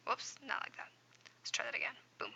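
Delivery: whispered self-correction; soft unvoiced whisper aside. Real, not acted. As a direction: murmur it to yourself while fixing it. Aside fixing a mistake.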